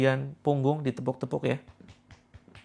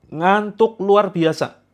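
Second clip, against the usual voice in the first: high, loud and slow; dramatic emphasis; 5 semitones higher, 8 dB louder, much slower than usual. Acted. Healer stressing the symptom to listeners, loud and drawn out. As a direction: stretch out the drowsiness for effect.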